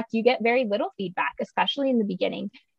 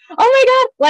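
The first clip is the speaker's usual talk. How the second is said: mock panic; 9 dB louder than usual.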